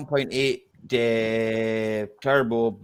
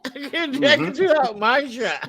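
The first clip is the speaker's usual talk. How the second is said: high mock brag; 12 semitones higher than usual. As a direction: brag in a squeaky mocking voice.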